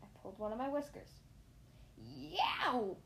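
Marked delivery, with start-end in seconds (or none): 2.0-3.0 drawn-out yelp of pain; pained "yow" cry. A narrator's reading. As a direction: yelp as if a whisker was pulled. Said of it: "I yelped".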